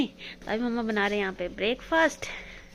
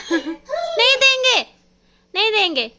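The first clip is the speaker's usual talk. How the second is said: high, slow laughing refusal to the puppy; high giggling pet-talk; laughing at the dog; 12 semitones higher, 11 dB louder than usual. Real, not acted. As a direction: tease the dog through a laugh.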